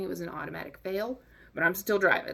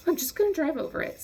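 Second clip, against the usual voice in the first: fast, high-pitched burst of decision; squeaky, hurried self-convincing; frantic resolve; 9 semitones higher, faster than usual. Retold or imitated. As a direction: blurt it quickly, pitched way up. Deciding in head, heightened repeat.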